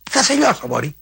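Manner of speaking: hammed-up yell of a crushing threat; mock furious threat. Makris threatening the fly again.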